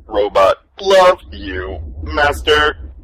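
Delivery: low robot voice with a sob; a machine choking up on love. Acted. Robot confesses love, crying.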